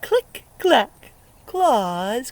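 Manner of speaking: ordinary talk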